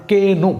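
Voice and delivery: "কে নো" with the K sounded as a full "kay"; wrong reading "কে নো", kay-no; K pronounced. Shown as a demonstration. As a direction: say "কে নো", voicing the silent K as its own syllable. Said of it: "কে এর উচ্চারণটা আমরা পরিপূর্ণরূপে করতে চাই".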